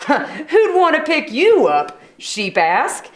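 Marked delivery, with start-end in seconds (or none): 0.1-2.0 higher, laughing taunt. Acted. Sheep teasing Porcupine, laughing. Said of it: "laughed his bleat of a laugh".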